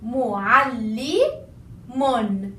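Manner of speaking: mu'allimun very slow with held ll; slow doubled lam. Shown as a demonstration. Teacher drills the shadda slowly. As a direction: spell out mu'allimun. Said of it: "concentrate on the shadda".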